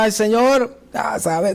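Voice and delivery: reluctant, lackluster call to the Lord; mock half-hearted prayer. Believer praying to the Lord against their will, half-hearted. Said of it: "así de mala gana".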